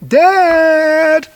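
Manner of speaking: put-on a high, loud child's call from the next room. Young daughter calling her dad at night, loud high call. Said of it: "my daughter calling out".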